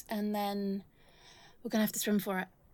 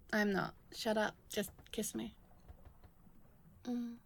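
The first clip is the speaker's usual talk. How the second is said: normal talking voice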